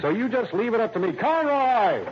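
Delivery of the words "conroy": higher, louder call across the office. Acted. Lieutenant calling his officer in from outside, loud call. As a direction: summon him from the next room.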